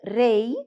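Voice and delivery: "rei" ending in a vowel glide; Spanish "rei", final Y said as I. Shown as a demonstration. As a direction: let "rei" close on an I sound. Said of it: "o som de vogal".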